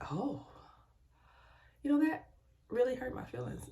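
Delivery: hurt, high voice. Retold or imitated. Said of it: "I'm like".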